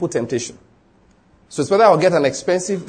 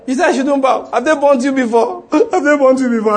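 mocking complainer; 9 semitones higher, 4 dB louder than usual. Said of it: "And I say".

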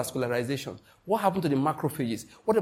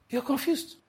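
high mock exclaim; 10 semitones higher than usual. Preacher teasing his congregation, high mock exclaim. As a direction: jump way up in pitch for the repeat.

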